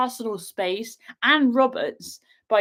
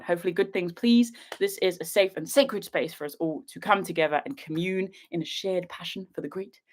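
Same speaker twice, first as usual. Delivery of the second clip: usual speaking voice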